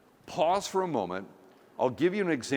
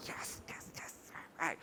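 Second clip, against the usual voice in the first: whispered yes yes to himself; gleeful whisper; 4 semitones higher, 16 dB quieter than usual. Retold or imitated. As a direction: whisper it like a secret victory. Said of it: "And now I'm going".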